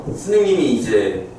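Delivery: ordinary delivery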